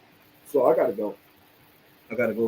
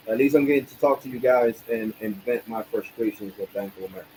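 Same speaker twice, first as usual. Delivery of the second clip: ordinary talk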